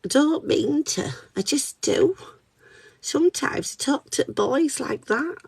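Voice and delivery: high-pitched cartoon kitten voice; Candy Cat. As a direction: put on a little cartoon cat voice. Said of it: "impression of Candy Cat from Peppa Pig".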